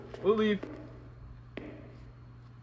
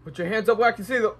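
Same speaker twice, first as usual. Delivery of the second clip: raised, high-pitched mock order to surrender; mock police command; 9 semitones higher, 8 dB louder, faster than usual. Player challenges an unseen figure in the game, mock commanding shout. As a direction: shout it like a cop, in play.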